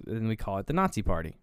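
usual speaking voice

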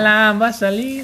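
high, slow eerie calling of a name; ghostly call. Retold or imitated. Friend mocking a spooky stranger calling Alan, high and eerie. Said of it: "Le gritaba".

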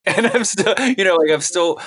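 real laugh breaking into speech. Real, not acted. Laughs while insisting he is happy.

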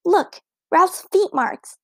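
high, slow delighted exclamation; child's excited cry; excited exclaim. A narrator's reading. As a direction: show off the find with glee. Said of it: "exclaimed Ramona".

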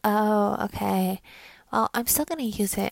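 lightly voiced Valley Girl; a hint of Valley Girl in a retold reply; mock Valley Girl. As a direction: imitate an annoying Valley Girl. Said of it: "why am I doing Valley Girl".

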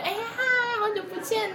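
bright high-pitched hello; cheery greeting. Retold or imitated. Retelling how she greeted her former coworker, bright and chirpy.

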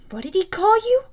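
ordinary delivery, nothing marked

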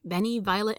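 plain reading voice

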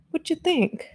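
put-on a playful baby-talk question; cutesy high voice. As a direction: ask it again in a sweet, silly high voice. Repeats the question to chat.

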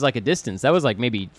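ordinary talk, nothing marked

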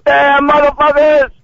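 high, drawn-out hammed-up cursing; mock angry yelling. Prankster curses officers on command, mock angry yelling. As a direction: hurl it out in pretend outrage.

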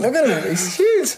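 high-pitched gushing onlooker; mock awe. Acting an awestruck admiring onlooker.

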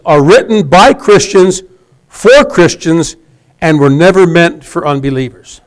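langzaam, elk woord los uitgesproken; slow deliberate quote. Shown as a demonstration. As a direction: lees het citaat traag en nadrukkelijk voor. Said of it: "Is that true or false?".

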